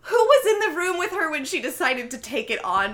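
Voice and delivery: high, loud mock disbelief; incredulous outburst. Retelling a reaction to an actress's silly role, loud incredulous delight. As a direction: cry it out in hammed-up astonishment. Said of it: "I was like".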